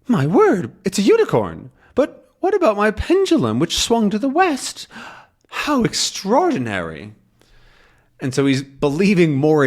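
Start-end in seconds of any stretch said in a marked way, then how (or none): 0.1-7.1 amazed professor. Acted. Calculus seeing the unicorn, high and astonished. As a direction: gasp out the surprise, then wonder. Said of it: "And then he says".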